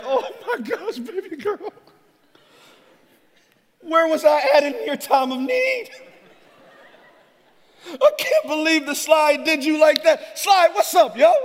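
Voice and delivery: dad freaking out over a slide; frantic dad. Retold or imitated. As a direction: overreact wildly to a tiny hurt. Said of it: "I'm like".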